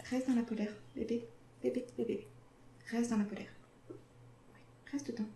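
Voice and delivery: soft hushed coaxing; whispering over a crib. Real, not acted. Soothing baby squirrel quietly.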